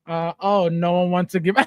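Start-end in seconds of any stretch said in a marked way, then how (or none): none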